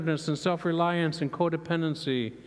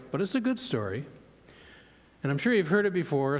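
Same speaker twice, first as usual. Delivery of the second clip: ordinary talk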